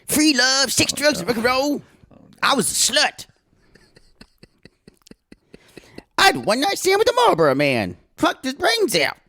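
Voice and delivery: put-on high, fast gossipy older woman; Mother Debbie impression. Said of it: "Mother Debbie's a dick pig".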